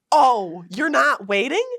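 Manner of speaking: loud, disbelieving echo of her words; mock surprise. Hiker retelling her loud reply to her friend, mock disbelief. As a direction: throw her words back, loud and incredulous. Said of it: "And I was like".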